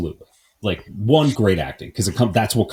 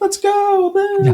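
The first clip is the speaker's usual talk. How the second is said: squeaky, much higher "Yay!" like a happy little cartoon bird; much slower than usual. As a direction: cheer like a tiny Looney Tunes bird. Voicing the cartoon birds.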